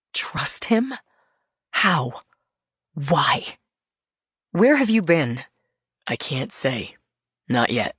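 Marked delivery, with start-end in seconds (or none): none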